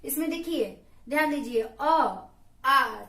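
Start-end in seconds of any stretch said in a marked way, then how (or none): 1.8-3.0 अ short then आ drawn out long; अ brief, आ held long; long vowel demo. Shown as a demonstration. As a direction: stretch आ to two mātrās after a short अ. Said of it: "समय भी ज्यादा लगा".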